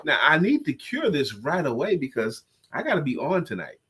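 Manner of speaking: normal talking voice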